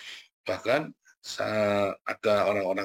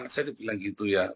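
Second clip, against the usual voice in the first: usual speaking voice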